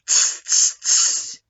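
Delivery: voiceless monkey tsking. Acted. Monkeys mocking the angry peddler with voiceless tsks. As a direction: tsk back at him like a monkey.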